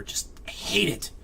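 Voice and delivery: slow, breathy whisper under the breath; soft, slow whispered grumble; whispered aside. Real, not acted. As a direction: let it slip out as a hushed mutter. Aside after complaining about hovering.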